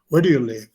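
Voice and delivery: "Where do you live" with a falling tune; falling intonation. Shown as a demonstration. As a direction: drop the pitch through to "live". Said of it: "La entonación cae".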